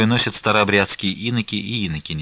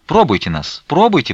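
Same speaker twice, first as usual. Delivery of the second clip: louder pleading, jumping higher on the repeat; insistent pestering; 6 semitones higher, 6 dB louder than usual. A narrator's reading. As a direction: pester him eagerly. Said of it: "приставали белицы".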